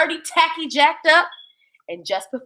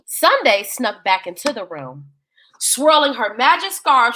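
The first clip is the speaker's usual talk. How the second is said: usual speaking voice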